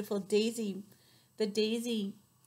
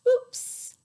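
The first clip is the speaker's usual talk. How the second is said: very high, breathy little oops; a tiny guilty squeak; bunny caught making a mess; squeaky oops; 10 dB louder than usual. Acted. Character drops something, whispered.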